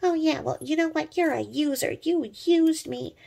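high, mocking whiny voice; mocking the YouTuber. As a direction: sneer it out like a petty rant. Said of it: "She's like".

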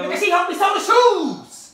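high, loud shout; loud provoking retort; shouted taunt. Real, not acted. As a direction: yell it high to wind them up. Prankster taunts friend about shoes.

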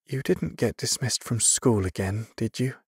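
disappointed. A narrator's reading. Mother questioning her son about school, disappointed. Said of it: "her face drawn with disappointment".

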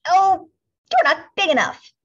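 high, loud dismissive brush-off; scornful ladybug. A narrator's reading. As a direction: wave them off with contempt. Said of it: "said the grouchy ladybug".

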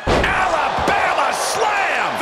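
drawn-out high call of the move; slow, high announcer shout; excited. Acted. Move called in match commentary.

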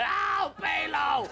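slow, very high mock wail; scream. Calling after the vanished caller, hammed-up wail. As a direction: stretch it out like a lost love. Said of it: "as I'm screaming at that".

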